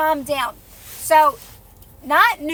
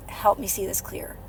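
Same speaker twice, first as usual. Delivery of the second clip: quiet, relaxed plea; soft meditative; 5 semitones lower, 10 dB quieter than usual. Shown as a demonstration. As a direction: breathe it out softly. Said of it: "relaxed, soft".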